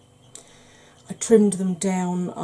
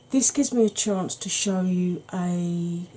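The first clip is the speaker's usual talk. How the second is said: ordinary talk, nothing marked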